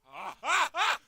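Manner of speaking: short, loud rising "ah" bursts; real panicked yelps; startled yells. Real, not acted. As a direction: yelp repeatedly in alarm. Startled in game.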